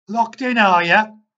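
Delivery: shouting through the locked door. A narrator's reading. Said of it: "he shouted through the door".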